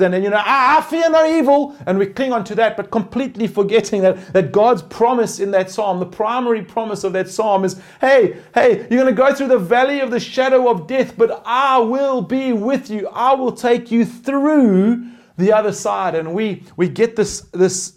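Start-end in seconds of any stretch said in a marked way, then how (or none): 0.4-1.7 mock bravado. Mock bold Psalm quote. 8.0-16.1 God reassuring. Pretend. God's promise voiced.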